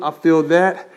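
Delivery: ordinary delivery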